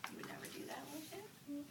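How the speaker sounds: soft whispered question; whispered aside. Real, not acted. Reader whispering a question to the listening child. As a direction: hush it to the listener. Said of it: "reader whispers aside to child".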